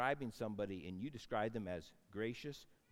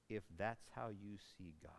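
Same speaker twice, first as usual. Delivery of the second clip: hushed whisper; 5 semitones lower, 10 dB quieter than usual. Real, not acted.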